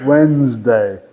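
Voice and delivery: Wednesday as WENZ-day, low and falling; silent D in Wednesday. Shown as a demonstration. Teacher after criticising the sounded D, correct Wednesday demo. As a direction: drop the first D in Wednesday. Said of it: "a pronunciar o D. Muito mal".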